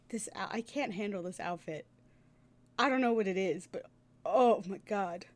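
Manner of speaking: ordinary delivery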